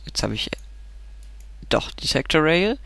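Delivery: ordinary delivery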